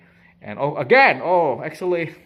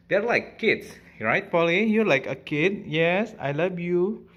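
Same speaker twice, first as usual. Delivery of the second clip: higher, tender pet talk, slowing on the last line; cooing to the parrot; 5 semitones higher, 4 dB louder than usual. Real, not acted. Owner coos lovingly to parrot Polly on her shoulder.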